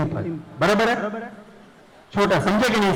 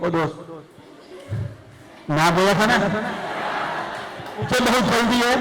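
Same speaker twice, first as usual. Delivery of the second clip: acted scolding; 4 semitones higher than usual. Shown as a demonstration. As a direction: scold the one who turned too soon. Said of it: "मैं दिखा रहा हूँ acting".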